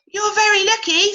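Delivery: a springy grasshopper calling out; bouncy grasshopper. Acted. Grasshopper speaks to Cody.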